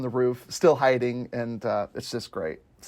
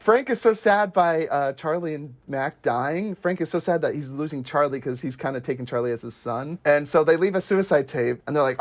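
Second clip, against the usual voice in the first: ordinary talk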